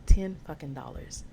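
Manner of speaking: ordinary delivery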